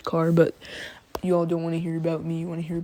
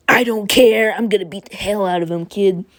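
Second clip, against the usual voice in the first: loud, raised-pitch mock yelling; meathead bravado; 3 semitones higher, 8 dB louder than usual. Meathead rival wanting payback, blustering shout. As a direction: bellow it like a hot-headed brawler. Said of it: "the meathead that he is".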